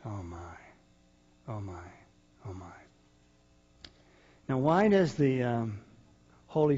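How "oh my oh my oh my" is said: much softer, lower, marvelling; awed hush. Real, not acted. Preacher marvelling under his breath after the verse. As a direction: murmur it in quiet awe.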